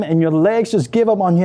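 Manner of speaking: plain reading voice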